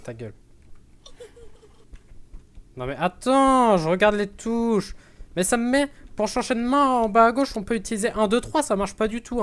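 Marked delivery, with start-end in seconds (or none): 2.8-9.4 higher, louder flustered rant; flustered excited. Real, not acted. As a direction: panic over the controls. Flustered by game controls.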